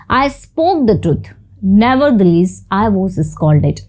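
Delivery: very slow, each word spelled out for learners; slow example reading. Shown as a demonstration. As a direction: read it slowly like dictation to a class. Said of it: "teacher reads example sentence". (धीरे-धीरे, साफ़ उदाहरण वाक्य)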